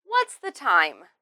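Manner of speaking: "What's" and "time" emphasized, ending in a fall; falling finish on "What's the time". Shown as a demonstration. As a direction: stress "What's" and "time", drop the pitch at the end. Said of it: "emphasize what's and time, and then end it".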